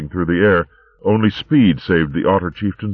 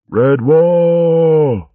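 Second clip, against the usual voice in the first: a charging warrior's shout; battle cry; 12 semitones higher, 6 dB louder than usual. Acted. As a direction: roar it, high and loud.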